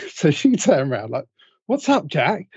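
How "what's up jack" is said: a hint of a higher voice for Jilly; mock her reply, light and higher; casual woman's reply, lightly acted; lightly voiced woman. Jilly voiced turning round.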